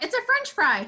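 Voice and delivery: put-on high-pitched little kid; toddler voice. Little girl shown an icicle, childlike and sure. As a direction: imitate a small child announcing a discovery. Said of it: "and she's like".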